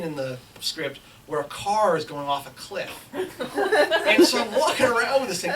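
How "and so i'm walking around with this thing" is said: ordinary talk, nothing marked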